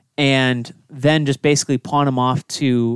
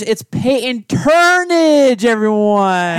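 higher, louder, slow ring announcer; announcer intro; 8 semitones higher, 4 dB louder, much slower than usual. Acted. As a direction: stretch the name like a hype announcer. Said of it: "Ladies and gentlemen".

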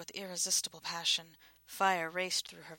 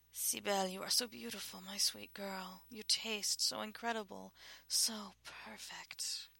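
breathy groaning whisper; 2 semitones higher than usual. Acted. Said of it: "the Shadil groaned".

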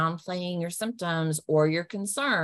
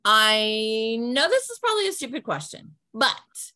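playful and a bit higher; kidding around; 6 semitones higher, 4 dB louder than usual. Shown as a demonstration. Self-mocking caller to office staff, playful. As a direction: joke about yourself to get a laugh. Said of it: "Or kidding around and saying".

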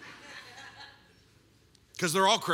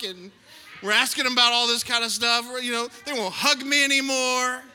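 desperate parent; 4 semitones higher, 3 dB louder than usual. Acted. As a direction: beg like an exhausted dad. Desperate parent begging youth leaders.

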